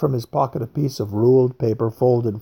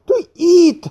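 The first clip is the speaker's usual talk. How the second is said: eager outburst; 7 dB louder than usual. A narrator's reading. Craxy blurts out first.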